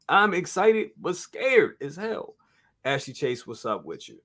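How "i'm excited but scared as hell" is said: excited. Real, not acted.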